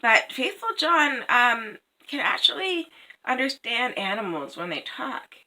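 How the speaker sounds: plain reading voice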